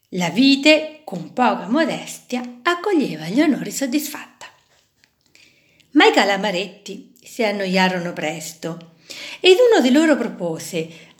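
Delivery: ordinary delivery, nothing marked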